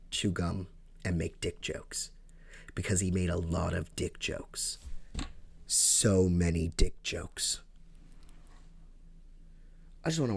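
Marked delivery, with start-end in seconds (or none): none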